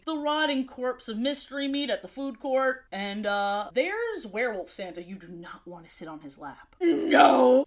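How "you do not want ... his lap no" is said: hushed then sudden loud NO; spooky warning. Acted. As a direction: scare them off the creepy lap. Creepy werewolf Santa gag.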